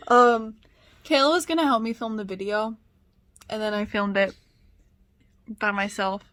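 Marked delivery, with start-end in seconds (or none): none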